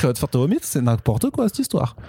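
put-on exasperated comic Alsatian accent; mock indignation in Alsace accent, rising high at the end; eastern French accent. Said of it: "on dirait vraiment que c'est naturel".

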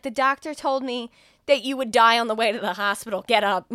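put-on loud panicked girlfriend yelling; panicked shout. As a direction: yell it like she's desperate to save him. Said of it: "And she's like".

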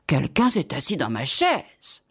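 lightly voiced middle bear; hint at a medium-sized bear, not too big; middle bear's medium voice. A narrator's reading. Middle bear finds the chair squashed, in a medium voice. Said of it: "de sa voix moyenne".

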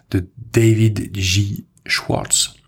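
French podcaster reading the author's name, English accent; heavy English accent. Real, not acted. Host names the book's author in English accent. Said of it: "Désolé pour mon accent anglais à couper au couteau".

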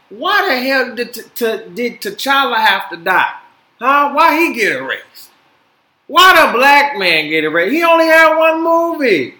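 loud, high indignant rant; heated fan outrage; outraged rant. Real, not acted. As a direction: vent loudly at the injustice. Ranting about T'Challa dying.

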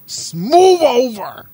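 high, slow mock announcer pushing in; hammed-up newscaster taking over; mock anchor. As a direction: shove in like a TV anchor. Imitating news anchor pushing in.

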